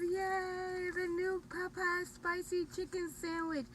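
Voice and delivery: high, bright show-off announcement; excited. Real, not acted. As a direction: present it like a prize. Excited reveal of new sandwich.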